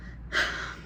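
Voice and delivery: long, voiceless exhale of disgust; disgusted sigh. Real, not acted. Vlogger recalling a gross phone call, disgusted sigh. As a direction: let out a weary, grossed-out sigh.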